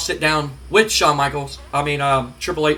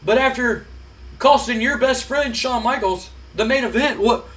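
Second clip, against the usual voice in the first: imitating Jim Ross; 5 semitones higher than usual. Jim Ross interviewing Triple H.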